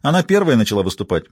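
usual speaking voice

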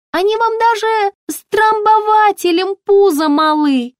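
very high, chirpy shop assistant; high-pitched, ringing sales voice; ringing saleswoman voice. A narrator's reading. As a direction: pipe up brightly through the door.

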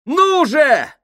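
high urgent yell; shouting. A narrator's reading. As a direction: shout him on. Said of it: "закричал Джордж".

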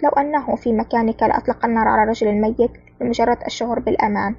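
plain reading voice

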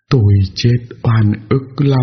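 slow, cold, mournful ghostly voice; a ghost grieving inside your head; whispery telepathic voice. A narrator's reading. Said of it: "Một giọng nói lạnh lẽo vang lên trong đầu tôi".

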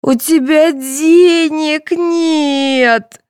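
put-on very high, slow childish whine; wailing little girl. A narrator's reading. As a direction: stretch the words into a tearful wail. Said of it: "рыдала Светлана".

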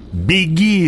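high-pitched cry at moderate volume; restrained narrated cry. A narrator's reading. Jessica to Paul as the worm approaches, high urgent cry. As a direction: urge him to flee, pitch leaping up. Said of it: "закричала Джессика".

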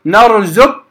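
نرزق said as narzukk, qaf turned to k; wrong merged reading. Shown as a demonstration. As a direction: close نرزق on a doubled kaf. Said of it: "خطأ".

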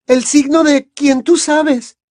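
very high, slow, loud dread; terrified warning. A narrator's reading. Hermione warning her friends about the mark, terrified. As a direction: spell out the danger, pitched high.

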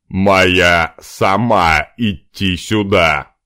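hurried, loud broken caveman talk. Acted. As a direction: blurt it out like a caveman. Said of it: "заторопился объяснить Димка". (пещерный человек, торопливо)

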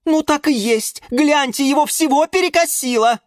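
high, loud dismay; alarmed exclamation. A narrator's reading. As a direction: point out the horror at high pitch. Plants exclaim over the swollen patient. (громкое испуганное восклицание)

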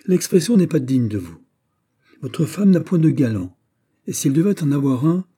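high, loud indignant rebuke; exclaimed. Acted. Mother rebukes her son's word about his wife, exclaimed. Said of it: "s'écria Anne d'Autriche".

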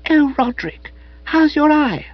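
put-on high, breathy soppy young woman; Madeline's voice. A narrator's reading. Madeline fussing over Spode's eye, high and soppy. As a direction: lift the voice high and sweetly girlish.

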